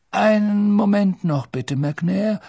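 put-on high, courteous old man's call; Dumbledore/character. A narrator's reading. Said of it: "erklang Dumbledores Stimme".

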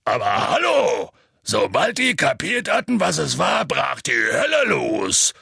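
bursting out with the story's climax; excited. Acted. Hagrid erzählt Tumult. (aufgeregt, laut und hoch)

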